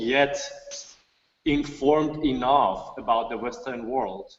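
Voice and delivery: ordinary talk, nothing marked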